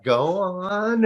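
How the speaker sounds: intrigued. Retold or imitated. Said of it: "I'm like".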